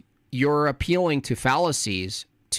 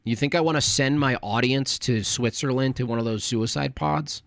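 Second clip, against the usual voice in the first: normal talking voice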